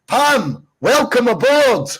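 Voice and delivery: high, drawn-out guest introduction; rousing welcome. Real, not acted. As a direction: call out a big hearty welcome. Host introduces new guest.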